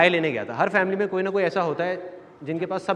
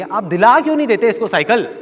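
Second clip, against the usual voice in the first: raised, louder plea to a husband; retold pleading; 5 semitones higher, 7 dB louder than usual. Mother asks father to buy the cycle, insistent. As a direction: imitate a mother pressing her case.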